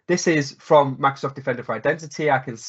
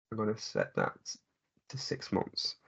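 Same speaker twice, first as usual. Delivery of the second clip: very soft, trailing into a near whisper; murmured self-talk; 2 semitones lower, 14 dB quieter than usual. Real, not acted. Presenter talking to self while setting a demo filter, murmured. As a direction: murmur it under the breath.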